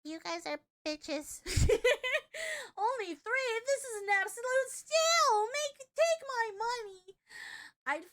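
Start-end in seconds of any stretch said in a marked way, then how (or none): 1.4-2.7 big burst of real laughter; loud laugh. Real, not acted. Streamer laughing at the price. Reacting to cheap deal. 2.8-7.1 high, loud mock outrage at a bargain; excited. Real, not acted. Streamer hyping a cheap price, high and loud.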